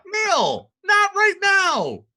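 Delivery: high, loud laughing protest. Real, not acted. Scolding co-host, laughing.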